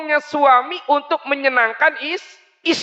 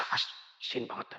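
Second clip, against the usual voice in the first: whispered; 19 dB quieter than usual. Acted. Prophet tastes salty drink.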